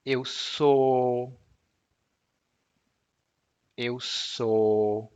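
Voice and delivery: eu sou with sou as a pure O, jaw still; Portuguese eu sou. Shown as a demonstration. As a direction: keep sou as one vowel. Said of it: "See, doesn't move".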